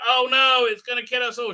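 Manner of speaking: high, hammed-up villager alarm; comic panicked wail; mock panic. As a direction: cry out the doom in fake fright.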